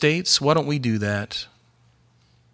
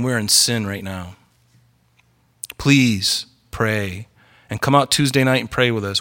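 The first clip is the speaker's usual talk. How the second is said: ordinary talk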